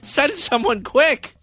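put-on high, panicked elderly caller; a shaky old caller begging for help; old person voice. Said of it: "elderly people called this line".

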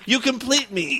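ordinary talk, nothing marked

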